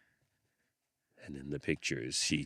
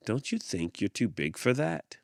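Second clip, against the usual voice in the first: chiding adult; 8 semitones higher, 5 dB louder than usual. A narrator's reading. Adult scolds girl.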